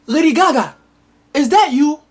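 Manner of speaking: high, hammed-up queen calling out; queen's voice. Shocked queen to her lost daughter, high and hammed-up. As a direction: ham up the shocked queen's cry.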